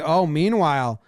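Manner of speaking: ordinary talk, nothing marked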